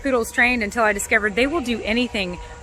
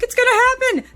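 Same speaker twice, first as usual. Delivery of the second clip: high, loud, thrilled anticipation; shaking with binge excitement; craving excitement; 5 dB louder than usual. Retold or imitated. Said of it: "I was like".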